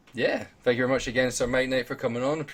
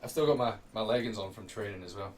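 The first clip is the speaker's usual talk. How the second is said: straining during push-ups, quiet; sore muscles; 11 dB quieter than usual. Real, not acted. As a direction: push out words while straining. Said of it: "my arms are killing me".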